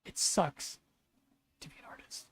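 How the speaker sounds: bitter whisper; real resigned whisper; whispered gripe. Real, not acted. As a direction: breathe it out under your breath. Bitter aside while frustrated.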